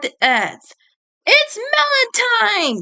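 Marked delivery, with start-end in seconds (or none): 1.2-2.8 much higher, gleeful battle cry; Johnny battle cry. Acted. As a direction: charge in crying it out. Johnny charging.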